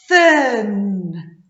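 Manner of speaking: Thin with a long stretched th; slow exaggerated speech modeling. Shown as a demonstration. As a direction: draw out the th in Thin. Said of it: "modelling the word".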